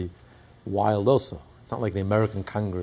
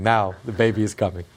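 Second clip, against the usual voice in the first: usual speaking voice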